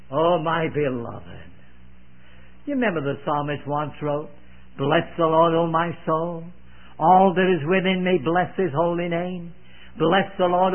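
ordinary talk

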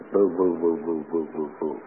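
mock the flat rhythm with empty syllables; mocking monotone. Shown as a demonstration. Preacher mocking a reader's monotone, droning boo boo. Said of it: "What does that mean?".

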